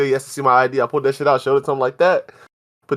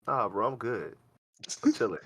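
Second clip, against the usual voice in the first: very soft, low-key decline; small, hushed mellow voice; stoned refusal; 3 semitones lower, 12 dB quieter than usual. Retold or imitated. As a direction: barely say it, begging off. Narrator meekly declining to dance.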